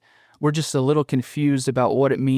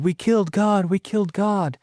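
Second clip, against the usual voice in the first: higher-pitched cry at ordinary volume; retold scream, raised in pitch but held back; mock scream; 7 semitones higher than usual. Host retelling Nietzsche's madman running into the city, mock scream. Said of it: "he's screaming".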